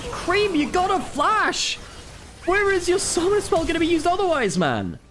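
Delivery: very high, stretched exasperated cry; emotional outburst. Real, not acted. Caster berating Cream over an unused flash, exasperated. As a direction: plead with the player at the top of the voice's range. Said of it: "getting a bit emotional about Cream".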